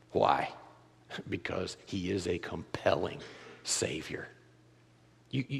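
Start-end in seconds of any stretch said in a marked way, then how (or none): 0.1-4.3 hushed. Real, not acted. Sermon climax drops away.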